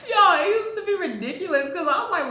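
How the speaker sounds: high laughing disbelief. Real, not acted. Laughing at memory.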